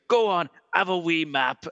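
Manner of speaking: broad imitated Glasgow accent; drawn-out friendly Glaswegian. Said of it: "But then Glasgow is a lot".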